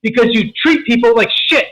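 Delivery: retold angry yelling. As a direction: yell a furious warning at your stepson. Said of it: "I just started yelling at him".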